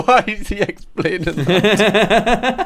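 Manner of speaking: slow, high laughing question. Real, not acted. Laughing at the interview.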